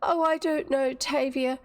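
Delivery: high, plaintive voice; mildly tearful, trying to compose herself; holding back tears. A narrator's reading. Dorothy checking her tears before Tavia, plaintive. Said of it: "she tried again to check her tears".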